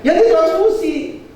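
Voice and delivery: high, drawn-out DITRANSFUSI; exaggerated doctor's verdict. Shown as a demonstration. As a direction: say "ditransfusi" high, slow and emphatic. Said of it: "Dia nggak ngomong gitu". ("ditransfusi" dengan nada tinggi, dipanjangkan)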